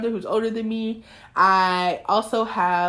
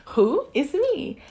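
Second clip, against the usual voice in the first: usual speaking voice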